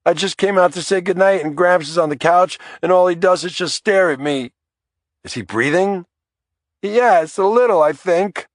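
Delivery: put-on a frightened child crying to his dad; boy bawling on the phone to his dad. A narrator's reading. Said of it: "through his bawling".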